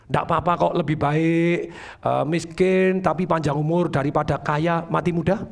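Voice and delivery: low, slow, drawn-out resignation; resigned quoted believer. Acted. Speaker mimicking someone who accepts being poor, resigned and slow. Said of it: "Ada orang yang ngomong begini".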